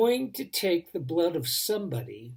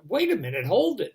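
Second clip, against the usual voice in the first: higher, louder cry to stop them; alarmed protest; 6 semitones higher, 6 dB louder, faster than usual. Retold or imitated. Father as his son is grabbed, loud alarmed protest.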